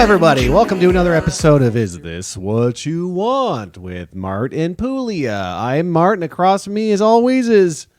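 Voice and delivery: loud, upbeat show-opening voice; podcast host intro. Acted. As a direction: welcome listeners like a host. Opens the podcast episode.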